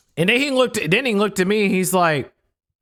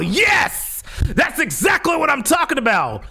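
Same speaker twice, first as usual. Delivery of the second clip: triumphant shout; 9 semitones higher than usual. Real, not acted. Triumphant cheer about the tackle.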